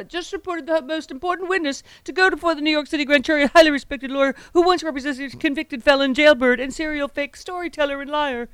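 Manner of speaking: mocking high-pitched politician's voice; high, put-on caricature of a ranting politician; Trump. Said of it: "real voice is actually that high pitched".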